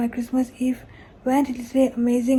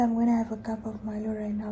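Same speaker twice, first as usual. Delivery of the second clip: ordinary talk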